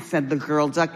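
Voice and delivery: ordinary delivery, nothing marked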